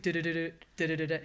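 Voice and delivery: rapid-fire du-du-du scanner noise; imitated rock-music noise. Patient describing MRI noise to host, imitated rhythmic racket. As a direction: mimic the pounding machine in quick repeated bursts. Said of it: "it sounds like rock music".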